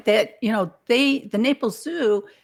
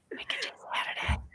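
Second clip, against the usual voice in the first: voiceless quick whisper; 10 dB quieter, much faster than usual. Real, not acted. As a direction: whisper back softly. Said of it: "so I have to whisper".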